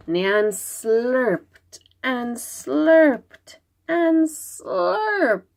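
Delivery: very slow, stretched narration of slurping; drawn-out slurping. A narrator's reading. Narrator drawing out the monster's slurping, slow. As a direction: stretch each slurp out long.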